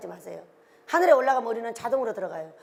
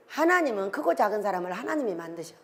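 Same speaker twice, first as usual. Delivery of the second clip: usual speaking voice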